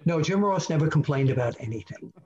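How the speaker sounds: usual speaking voice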